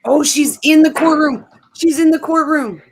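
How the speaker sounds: high, loud thrilled squeal, repeating it; excited squeal. Real, not acted. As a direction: gush like you just spotted her. Squealing over courtroom news.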